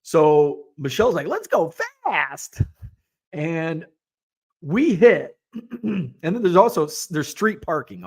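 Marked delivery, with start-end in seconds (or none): 1.3-2.6 high, drawn-out thrill; gleeful high-pitched dare; excited urging. Retold or imitated. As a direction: egg someone on, stretching the words high. Cousin urging a reckless ride.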